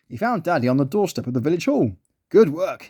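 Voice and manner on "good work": ordinary delivery, nothing marked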